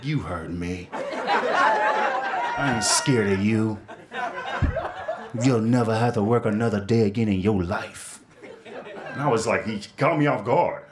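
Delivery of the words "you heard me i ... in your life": lightly voiced suitor, slow and smooth; slow smooth come-on. Man in the grocery store repeats his offer, slow and smooth. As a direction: draw it out like a confident flirt. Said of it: "He's like".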